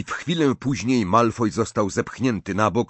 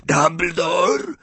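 higher, rasping taunting voice; snarling werewolf; 6 semitones higher, slower than usual. A narrator's reading. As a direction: bark it like a dog. Greyback taunts.

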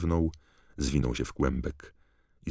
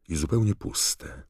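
usual speaking voice

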